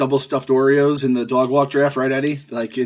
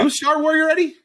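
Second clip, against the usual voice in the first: squeaky tease; 14 semitones higher than usual. Pretend. Teasing Eddie in a squeak.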